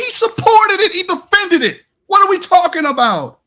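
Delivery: high-pitched, fired-up rebuttal; worked-up debater, voice high; heated rebuttal. Real, not acted. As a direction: press the attack, pitch way up.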